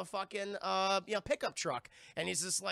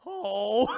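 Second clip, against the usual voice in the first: high, loud exclaimed "oh"; big cartoonish "oh" reaction; mock loud "oh"; 4 semitones higher, 11 dB louder than usual. Man reacting to his first try of a joint, mock loud "oh". Acting out character trying a smoke.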